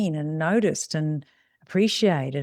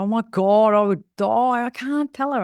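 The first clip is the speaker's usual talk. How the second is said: imitating a panicked client, a bit higher and louder; mock horror; 5 semitones higher, 5 dB louder than usual. Client recoiling from telling a customer about bad breath, in mock horror. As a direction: act out the client's dramatic dread.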